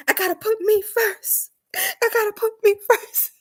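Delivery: high, playful mock insistence; high-pitched insisting. Telling a girl she must put herself first, high mock insistence. Acted self-talk, insisting, laughs after.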